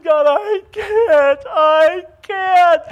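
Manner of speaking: high, loud, slow sobbing plea; crying prayer. Retold or imitated. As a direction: break down crying to God. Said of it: "And I was crying".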